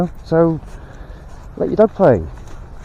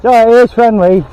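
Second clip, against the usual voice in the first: loud, high apology across the path; calling to a stranger; 7 semitones higher, 9 dB louder than usual. Real, not acted. Owner calls to a dog walker, loud and high. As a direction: reassure the other walker from afar.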